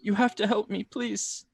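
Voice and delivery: whimpering plea. Acted. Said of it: "he's just whimpering".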